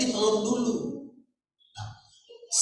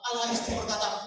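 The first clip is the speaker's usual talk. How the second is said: ordinary delivery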